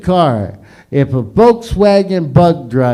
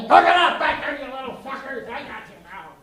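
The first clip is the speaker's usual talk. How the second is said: mock angry dad; 8 semitones higher, 5 dB quieter than usual. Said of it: "Remember that?".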